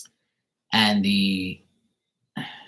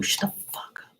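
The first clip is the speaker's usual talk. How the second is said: whispered aside; 6 dB quieter than usual. Retold or imitated. What he wanted to say.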